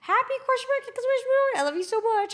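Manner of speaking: very high-pitched gushing read-out; Kaide. Retold or imitated. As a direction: gush the text, punctuation and all. Reads out friend's excited text.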